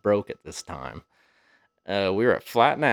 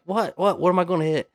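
higher-pitched startled questions; retold alarm; 7 semitones higher than usual. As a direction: mimic a driver suddenly alarmed, not shouting. Acts out alarmed driver's reply.

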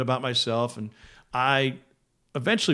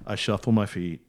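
ordinary talk

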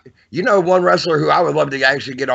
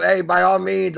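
usual speaking voice

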